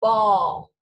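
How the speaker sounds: "Ball" said loud with a long "aw"; modeling bawl. Shown as a demonstration. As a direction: model "Ball" firmly for the class. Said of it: "the kind that means to cry".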